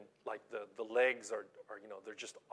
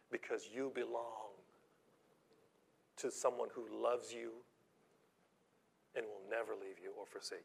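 usual speaking voice

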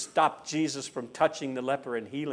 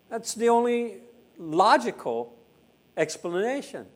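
ordinary delivery

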